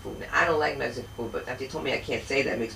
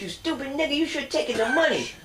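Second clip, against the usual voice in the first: scolding friend; 11 semitones higher than usual. Retold or imitated. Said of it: "jumps up and goes".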